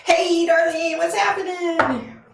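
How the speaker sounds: high, drawn-out singsong hello; high-pitched, stretched-out welcome; excited greeting. Real, not acted. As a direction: call out gleefully to a friend who just arrived. Greeting a friend joining the stream.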